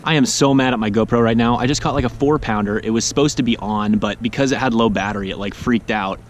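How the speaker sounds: ordinary delivery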